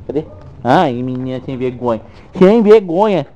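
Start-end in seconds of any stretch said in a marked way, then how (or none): 2.4-3.3 high, loud teasing scold; playful loud scold. Real, not acted. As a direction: tease the dog loudly.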